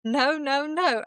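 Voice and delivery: rising, angry refusal; mock-furious repeated no. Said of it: "I was so mad".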